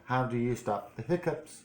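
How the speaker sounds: ordinary delivery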